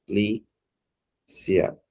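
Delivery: Polícia spoken very slowly as po-lí-ci-a with each syllable apart; split into syllables. Shown as a demonstration. Teacher confirming the pupils' division, slow and separated. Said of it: "como vocês fizeram".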